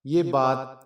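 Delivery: plain reading voice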